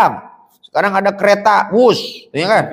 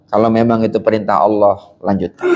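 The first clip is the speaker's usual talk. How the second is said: calm acceptance; 10 semitones lower than usual. Acted. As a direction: let him go gently, fading to almost nothing. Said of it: "dia kemudian berkata".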